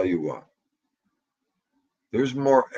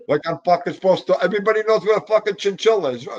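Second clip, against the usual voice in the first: sarcastic rant; 7 semitones higher, 4 dB louder, much faster than usual. Real, not acted. Sarcastic rant about the chinchilla.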